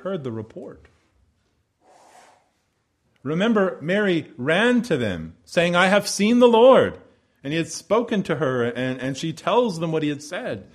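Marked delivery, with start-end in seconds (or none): 5.7-7.0 excited announcement. Acted. Mary's announcement acted out.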